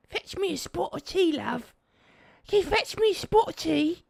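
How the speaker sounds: a prim lady asking for tea; mock posh British lady. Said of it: "dodgy Cockney accent is in it".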